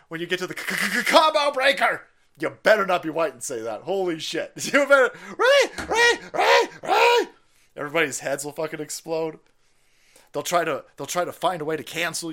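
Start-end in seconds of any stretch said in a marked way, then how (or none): 4.7-7.3 very high, loud squeaky voice; mocking falsetto squeal. Mock squeal, high and far.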